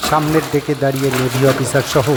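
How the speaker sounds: plain reading voice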